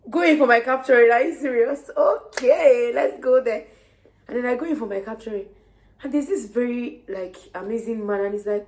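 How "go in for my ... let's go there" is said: loud, high, disbelieving repeat; incredulous echo. Retold or imitated. Repeating the man's strange word back in disbelief, loud and high.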